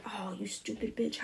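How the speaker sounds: scolding herself under her breath; harsh self-talk. Retold or imitated. To herself after a slip, a soft mock scold. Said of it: "I wasn't like".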